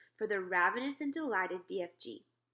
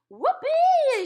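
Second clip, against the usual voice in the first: huge high whoop; shout; 16 dB louder than usual. Acted. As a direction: whoop with glee. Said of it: "shouted the B F G".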